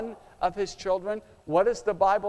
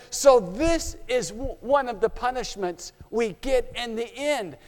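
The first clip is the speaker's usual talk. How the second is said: ordinary talk, nothing marked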